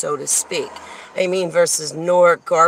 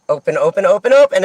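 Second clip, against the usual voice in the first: rapid, rhythmic chant getting louder; imitated doors opening; 2 semitones higher, 7 dB louder, faster than usual. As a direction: mimic doors popping open in sequence. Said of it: "And then I just hear".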